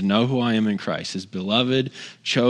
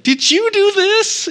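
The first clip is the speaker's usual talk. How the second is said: retold outburst, very high and loud; shocked confrontation; 6 dB louder, slower than usual. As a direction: imitate an appalled parent, slow and shrieking. Retold confrontation with his son.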